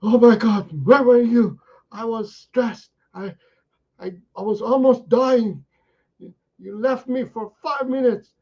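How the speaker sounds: the dog bursts out in stressed relief; frantic dog. Retold or imitated. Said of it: "is saying".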